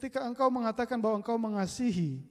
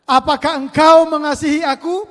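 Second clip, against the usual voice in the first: loud, higher earnest question; 9 semitones higher, 16 dB louder than usual. Acted. Jesus asks Simon.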